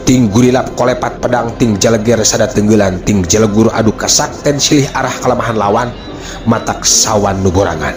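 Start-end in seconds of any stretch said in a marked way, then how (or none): none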